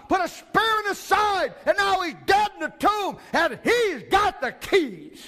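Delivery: high, loud, slow shouted preaching; full-force preaching. Acted. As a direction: thunder it from the pulpit, every phrase hammered. Building to the climax.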